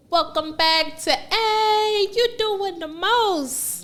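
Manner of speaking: slow, drawn-out show intro; announcer voice. Acted. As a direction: welcome listeners like a host.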